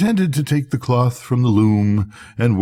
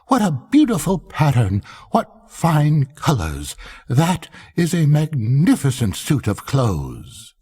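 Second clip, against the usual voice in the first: gushing courtiers; 6 semitones higher than usual. A narrator's reading. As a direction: lay the flattery on thick. All praising the suit.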